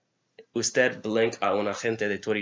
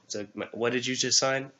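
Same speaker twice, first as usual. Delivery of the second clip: ordinary talk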